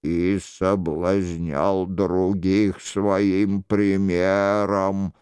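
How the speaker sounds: low, slow, solemn reproach; a solemn accuser from the dark, drawn out; stern mysterious voice. Acted.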